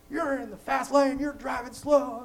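high, loud, slow mock yell at the slow driver; imitating a yell. Angry driver yelling at him from the fast lane, a high loud mock yell. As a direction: bellow it like the angry driver behind you. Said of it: "he's yelling at me".

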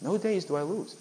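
ordinary delivery

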